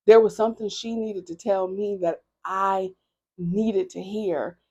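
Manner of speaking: ordinary delivery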